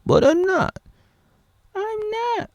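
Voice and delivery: sudden high squeak on the denial; mock squeak. Vlogger parodying Flopper's contradiction, squeaky high denial. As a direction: flip up into falsetto. Alternating paradox voices.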